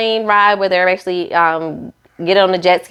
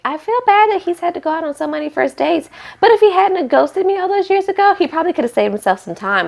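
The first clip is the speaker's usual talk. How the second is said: imitating Bao; 11 semitones higher, faster than usual. Voicing Bao's jab at Johnny.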